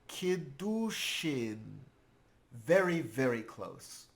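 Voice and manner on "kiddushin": very slow, syllable by syllable; sounding out. Acted. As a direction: work through "kiddushin" one drawn-out syllable at a time. Pupil sounding out a hard word.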